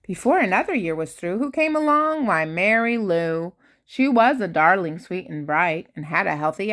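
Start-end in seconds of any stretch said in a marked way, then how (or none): none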